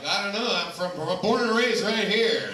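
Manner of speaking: mock drunk protest; loud exasperated drunk. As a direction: bluster back, overwhelmed and laughing. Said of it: "They're all like".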